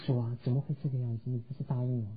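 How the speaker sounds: low, hushed question to God; retold pained prayer. Mother asking the Lord why her child stays silent, retold pained prayer. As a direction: quietly question a broken promise, imitating her pain. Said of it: "他非常的痛苦".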